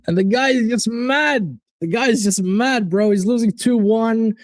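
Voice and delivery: usual speaking voice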